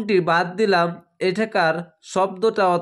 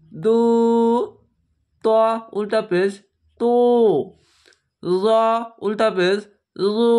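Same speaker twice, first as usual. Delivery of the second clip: letter drill; 3 semitones higher than usual. Shown as a demonstration. Teacher drills students through the alphabet, each sound held.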